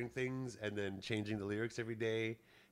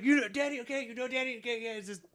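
much higher, flustered stammer; flustered brother; 13 semitones higher than usual. Retold or imitated. Lance caught changing the lyric, mock flustered. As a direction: squirm like you've been caught out.

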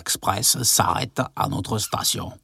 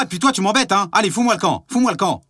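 furious yelling; 10 semitones higher, faster than usual. Acted. Husband ordering wife out.

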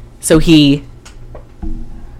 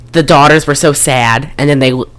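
ordinary delivery

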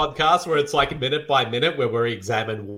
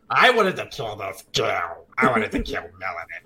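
killer voice; 4 semitones lower than usual. Pretend.

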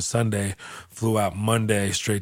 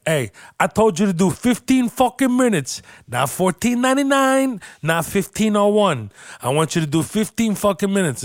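much higher, louder imitated chewing-out; retold boss yelling; 11 semitones higher, 4 dB louder than usual. Club booker scolding a comedian who ran long, imitated yell. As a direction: rip into the comic over the time limit. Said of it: "He would yell at guys".

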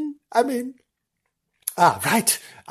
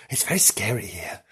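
scared whisper; 6 semitones lower, 7 dB quieter than usual. Acted. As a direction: whisper it as if afraid. Scared ghost-hunt commentary.

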